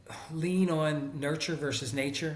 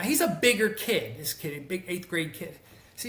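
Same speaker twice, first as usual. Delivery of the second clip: normal talking voice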